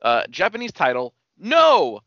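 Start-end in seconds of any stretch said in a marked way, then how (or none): 1.4-2.0 shocked no. Real, not acted. Reacting to the Japanese title.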